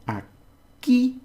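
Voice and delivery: "aquí" split, with the last syllable punched high and loud; stressed final syllable. Shown as a demonstration. Teacher shows learners where the stress is, final syllable of "aquí" stressed. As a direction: hit the KI of "aquí" after a gap. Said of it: "because we have the stress here".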